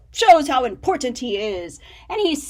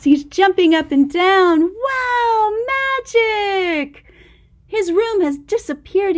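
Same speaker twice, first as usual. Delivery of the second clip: higher, bright, eager wonder; excited; 6 semitones higher, 5 dB louder than usual. A narrator's reading. Showing the child Max jumping, excited. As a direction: cheer at the magic.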